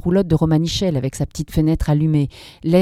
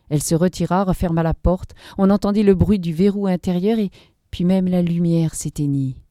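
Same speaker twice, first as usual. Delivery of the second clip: ordinary delivery, nothing marked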